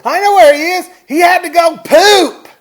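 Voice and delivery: high, full-force holler; shouted explanation. Retold or imitated. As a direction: imitate a man yelling the answer publicly. Retelling man's shouted explanation.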